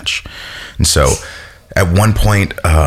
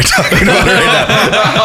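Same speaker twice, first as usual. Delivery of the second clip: tearful laugh. Real, not acted. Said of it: "I'm gonna cry".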